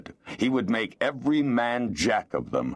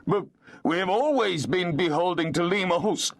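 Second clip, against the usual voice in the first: higher, sniveling plea; 12 semitones higher than usual. Acted. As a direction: snivel it piteously.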